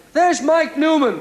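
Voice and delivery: high, loud, slow shout of recognition. Retold or imitated. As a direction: call out his name across the shopping centre. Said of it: "Somebody shouted".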